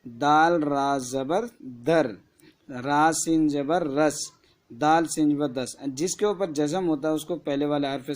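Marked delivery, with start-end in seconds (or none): 0.0-5.8 slow spelling out. Shown as a demonstration. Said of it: "الف بے زبر اب".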